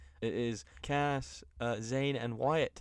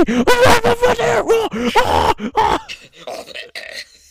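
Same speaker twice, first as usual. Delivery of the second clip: very high, loud drawn-out shriek; hammed-up ecstatic howl; crazed screaming; 16 dB louder than usual. Host mimicking commentator going crazy, wild screaming. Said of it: "He goes crazy".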